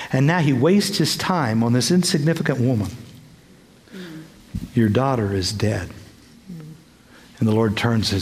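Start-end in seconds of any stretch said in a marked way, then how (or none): 4.7-5.9 grim news. Retold or imitated. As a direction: drop it low like a death notice.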